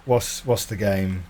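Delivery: usual speaking voice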